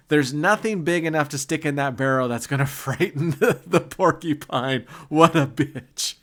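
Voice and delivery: can barely get the punchline out for laughing; laughing through a quote. Retold or imitated. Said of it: "she was laughing saying".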